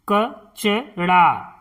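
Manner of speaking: कचड़ा split as क-च-ड़ा; syllable by syllable. Shown as a demonstration. Teacher sounding out कचड़ा for pupils. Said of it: "इस तरह से".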